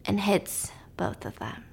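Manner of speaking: slow, soft whispered reveal; hushed whisper. A narrator's reading. Telling a friend the crash, hushed. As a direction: let the shock fall to a whisper.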